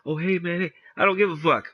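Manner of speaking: high, breezy don't-care; mock casual shrug. Rock to the man in the suit, high breezy mock shrug. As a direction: shrug it off grinning. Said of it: "He goes".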